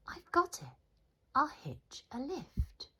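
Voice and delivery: high, bright burst of an idea; excited cry. Acted. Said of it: "then cried".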